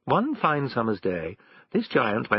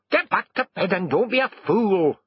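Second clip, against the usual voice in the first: higher-pitched, bossy wife voice; scolding wife; 9 semitones higher, 3 dB louder than usual. A narrator's reading. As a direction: order the husband back to bed. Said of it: "said his wife".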